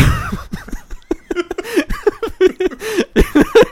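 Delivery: high, loud cackling laugh; burst of laughter. Real, not acted. Laughing hard while describing the Cartnark videos. Telling a funny video story.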